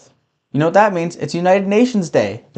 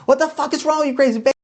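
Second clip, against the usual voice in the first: put-on boyfriend snapping; 11 semitones higher, faster than usual. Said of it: "And he's like".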